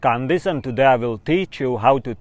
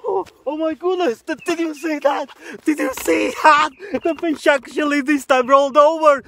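high-pitched, excited exclaiming; overjoyed amazement; 10 semitones higher, 6 dB louder than usual. Pretend. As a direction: burst out as if witnessing a miracle. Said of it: "I'm so excited".

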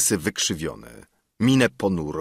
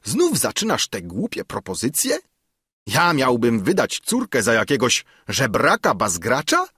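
put-on higher, scornful old councillor; indignant councillor; 5 semitones higher, 3 dB louder than usual. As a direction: scoff at the beggar suitor.

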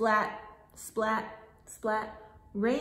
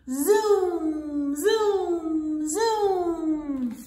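zoom sound effect; 5 semitones higher, 5 dB louder, much slower than usual. Acted. Kite sound effect in story.

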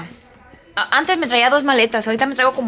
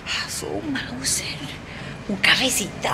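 hushed whisper; 10 dB quieter than usual. Real, not acted. Teacher in the cold morning whispering. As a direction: breathe it out in a whisper. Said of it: "cold morning, hushed".

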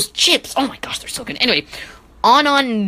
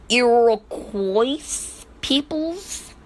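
very slow "Iroquois peoples", pitched up; drawn-out name; 5 semitones higher, much slower than usual. Real, not acted. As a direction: stretch it out and lift at the end, unsure. Sounds out a hard name unsurely.

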